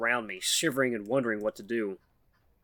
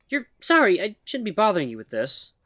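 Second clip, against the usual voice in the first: higher, halting nervous voice of a shy mouse; timid mouse woman; 8 semitones higher, 4 dB louder than usual. Acted. Shy mouse woman nervously calls to the human, halting and high. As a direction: stammer it out like a timid mouse calling for attention.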